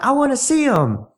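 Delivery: put-on high-pitched eager girl voice; imitated eager woman. Voices Diane's eager request.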